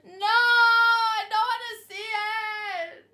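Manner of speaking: high, loud, slow wail as if in tears; mock crying. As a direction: whimper it out like a hammed-up sob. Refusing to look at the drawing.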